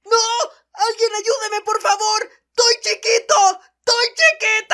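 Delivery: high, crying shouts for help. Acted. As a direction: wail it like a scared kid. Armin atado pide ayuda. (gritos llorosos y agudos pidiendo auxilio)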